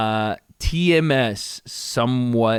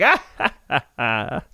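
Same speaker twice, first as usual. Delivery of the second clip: imitated obliging laugh; slower than usual. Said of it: "waits for him to laugh, and McKenzie's like".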